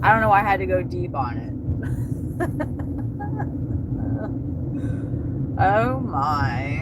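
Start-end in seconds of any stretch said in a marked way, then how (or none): 1.6-5.6 laughing. Real, not acted. Laughing at herself.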